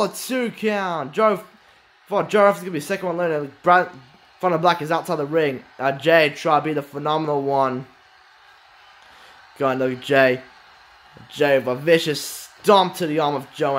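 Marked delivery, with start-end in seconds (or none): none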